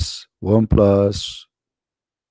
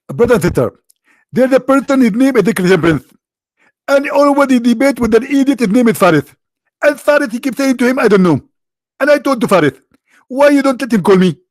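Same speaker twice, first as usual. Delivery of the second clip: much higher, loud preacher voice; Zakir Naik impression; 11 semitones higher, 5 dB louder than usual. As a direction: announce it like a fiery sheikh. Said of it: "I bought Zakir Naik voice too".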